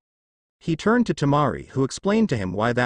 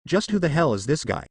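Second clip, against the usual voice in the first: usual speaking voice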